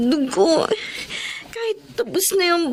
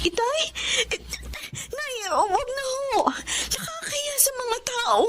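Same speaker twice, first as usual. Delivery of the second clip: high, soft, slow pleading through tears; choked-up daughter pleading softly; tearful pleading; 6 semitones higher, 9 dB quieter, slower than usual. Acted. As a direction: beg them to stop while crying. Daughter begs parents, crying.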